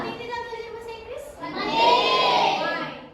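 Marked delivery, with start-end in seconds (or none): 1.4-2.6 class shouts the answer together, high and loud. Real, not acted. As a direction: everyone calls out at once. (seruan keras bersama)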